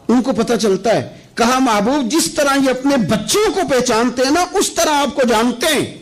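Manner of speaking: high-pitched, ringing sermon delivery; fervent preaching. Acted. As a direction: drive each phrase home to the congregation.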